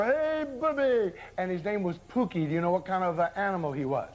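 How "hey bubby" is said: high, slow puppet call. Retold or imitated. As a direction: sing out like a cartoon puppet. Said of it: "a puppet that used to go".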